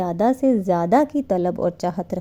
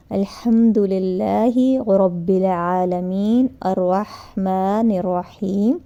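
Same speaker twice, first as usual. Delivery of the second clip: very slow, every word drawn out; slow recitation; much slower than usual. Shown as a demonstration. Teacher showing the right way to recite Al-Hamd: very slow and calm. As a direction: recite it unhurried, giving each syllable its place. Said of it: "اس طرح پڑھنا چاہیے".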